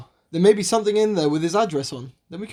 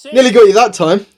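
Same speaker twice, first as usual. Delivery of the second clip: triumphant swat; 11 dB louder than usual. Acted. Swatting at the wasp.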